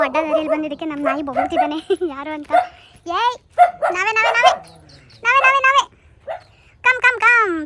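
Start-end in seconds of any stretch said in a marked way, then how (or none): 3.1-6.0 loud, high call that climbs, reassuring the barking dog; calling louder and higher each time. Real, not acted. 6.8-7.6 calling the dog over. Real, not acted.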